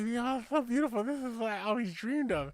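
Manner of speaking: a dreamy little man with a speech impediment. Retold or imitated. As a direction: joke teller voicing the little man admiring the horse. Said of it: "he's got a fucking speech impediment".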